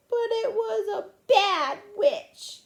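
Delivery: high, slow, loud whine; drawn-out tearful accusation. Acted. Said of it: "said Susan tearfully".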